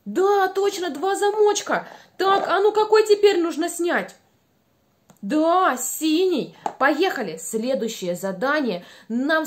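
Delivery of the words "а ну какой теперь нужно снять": normal talking voice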